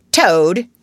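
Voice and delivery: loud, stern summons; severe. A narrator's reading. Badger addresses naughty Toad, severely. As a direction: call him sharply to account. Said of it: "he said severely".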